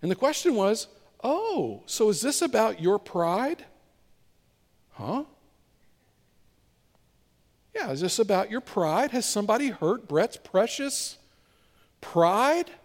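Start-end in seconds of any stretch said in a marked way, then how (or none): 1.2-12.7 gently teasing God. Retold or imitated. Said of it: "I heard God ask me a question".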